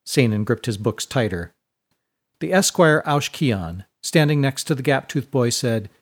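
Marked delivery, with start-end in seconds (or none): none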